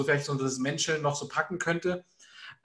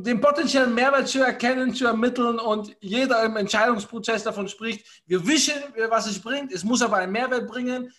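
a hammed-up Dutch accent, raised in pitch; playful Dutch accent, finishing the question; mock Dutch accent; 4 semitones higher than usual. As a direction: pick the Dutch accent back up after laughing. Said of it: "mit Scheveninger Akzent".

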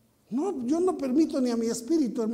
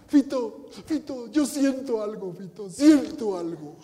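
slowed, tearful drunk imitation; a lightly voiced, tearful drunk in-law; weepy drunk relative; 2 semitones lower, 6 dB louder, slower than usual. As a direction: whine it like a sobbing drunk. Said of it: "estaba llore y llore".

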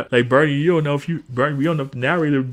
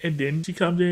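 normal talking voice

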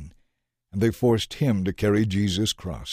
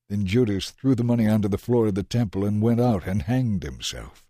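ordinary talk

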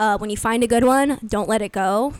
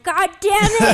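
loud frustrated curse; 10 semitones higher, 8 dB louder than usual. Retold or imitated. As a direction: yell it like a sore loser. Mimics rejecters punching air.

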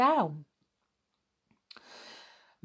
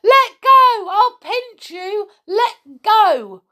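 very high, loud shrieked protest; screaming; 10 dB louder, much slower than usual. A narrator's reading. Connie caught by the ankle, screaming. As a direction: scream it like a frightened girl fighting free. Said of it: "She screamed".

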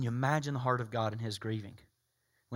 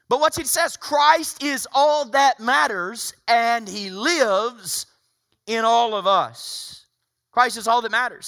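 raised, forceful sermon voice easing at the end; emphatic preaching; 7 semitones higher, 8 dB louder than usual. Real, not acted. Pastor reading Scripture on unity to his congregation, emphatic preaching. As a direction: proclaim it loud and high, then settle.

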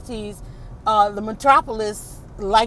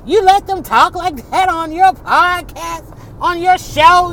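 slow, high-pitched mock scolding; scandalized mother; 6 semitones higher, 5 dB louder, slower than usual. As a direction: draw out the mother's disbelief, pitched up. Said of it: "my mother used to be like".

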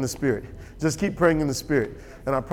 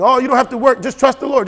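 mock quote; 9 semitones higher, 8 dB louder than usual. Mocking a view he rejects.

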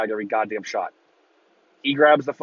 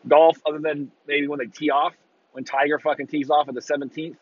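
usual speaking voice

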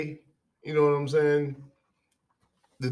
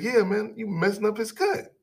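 high-pitched imitation of a complainer; mock insistent; 8 semitones higher than usual. Customer insisting to the barber that the cut is bad, mock insistent. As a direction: retell his griping in a raised pitch.

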